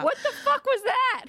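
high, loud outraged cry; high shocked outburst over the book; outraged disbelief. Real, not acted. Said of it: "outraged at a book scene".

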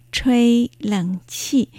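吹冷气 stretched out slowly, each syllable separate; model pronunciation. Shown as a demonstration. Teacher repeating the word after spelling its sounds, slow model pronunciation. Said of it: "吹の発音は".

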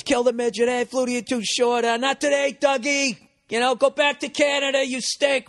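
high jeering crowd voice; mock the players like drunk end zone fans; imitating rowdy fans. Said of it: "So everybody's".

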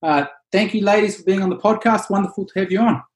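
bright announcer greeting the guests; radio host voice. Acted. Host's upbeat on-air welcome.

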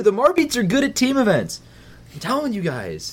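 high-pitched insisting. Real, not acted. Co-host telling the guys about team events, high-pitched insistence. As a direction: voice jumps way up, pleading the case.

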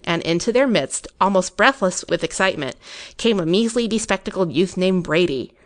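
usual speaking voice

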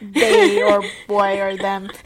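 stiff robotic listing voice. Real, not acted. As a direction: say it like a robot, word by word. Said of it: "Sound like a robot".

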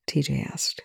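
ordinary delivery, nothing marked

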